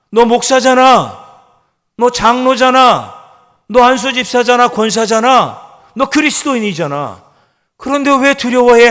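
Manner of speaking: loud, high, insistent rebuke; scolding himself. Acted. Preacher rebuking his fearful soul, loud and high. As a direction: hammer your soul with each question. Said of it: "이렇게 스스로에게 꾸짖고 대화하고".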